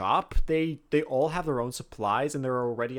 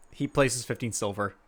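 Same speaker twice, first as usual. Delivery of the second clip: ordinary talk, nothing marked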